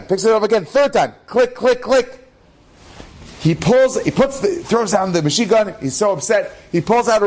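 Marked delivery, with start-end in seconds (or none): 1.3-2.2 imitated jammed gun clicking. Jammed submachine gun, imitated clicking. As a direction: click it out like a dead trigger.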